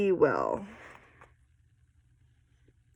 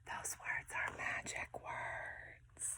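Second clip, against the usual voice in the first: slow secret whisper; 23 dB quieter, slower than usual. Acted. Teacher to children, whispering about magic words. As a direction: let it out like a secret.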